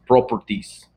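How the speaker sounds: "Properties" in an imitated Slovak accent. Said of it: "That's how he says it".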